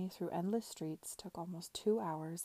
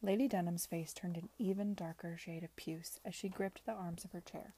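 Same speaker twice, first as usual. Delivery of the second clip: ordinary delivery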